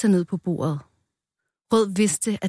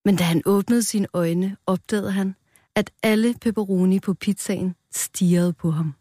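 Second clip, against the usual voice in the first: ordinary delivery, nothing marked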